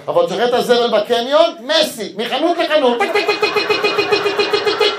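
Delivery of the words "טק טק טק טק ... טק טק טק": very high, loud clicking mimicry; high heel clicks. Comedian mocking girlfriend rushing through the mall, clicking sounds. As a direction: tap out her heels racing shop to shop.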